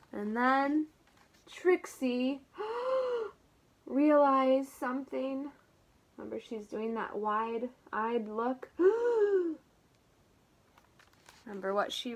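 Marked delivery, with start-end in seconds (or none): 2.5-3.3 high sudden gasp; realization gasp. Acted. Trixie realizing the mix-up, gasping. 8.7-9.5 high sudden gasp; realization gasp. Acted. Trixie realizing the mix-up, gasping.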